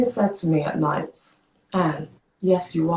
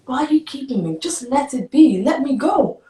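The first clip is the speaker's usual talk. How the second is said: high, louder, exasperated demand; retold angry plea; 9 semitones higher, 4 dB louder than usual. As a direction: act out begging angrily to be released. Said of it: "I was just so mad".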